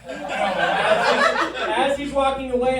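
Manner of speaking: ordinary talk, nothing marked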